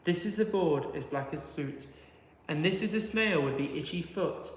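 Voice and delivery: ordinary delivery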